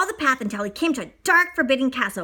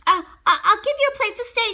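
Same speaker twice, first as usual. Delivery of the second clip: shouted; 9 semitones higher, 5 dB louder, faster than usual. Acted. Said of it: "shouted the beast".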